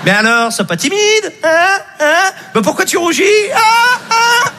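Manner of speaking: teasing baker. Retold or imitated. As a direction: laugh at the blushing kid. Baker mocking shy boy.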